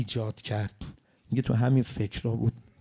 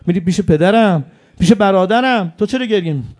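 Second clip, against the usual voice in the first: rising lament; 8 semitones higher, 8 dB louder than usual. Acted. Imam consoling the dying martyr.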